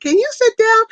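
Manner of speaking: high, loud mock complaint; put-on high-pitched pestering question; imitating sister. Acting out her sister's complaint.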